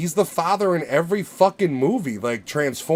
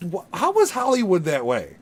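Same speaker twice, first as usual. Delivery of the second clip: normal talking voice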